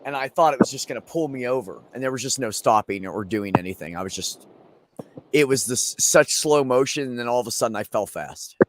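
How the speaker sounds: ordinary talk, nothing marked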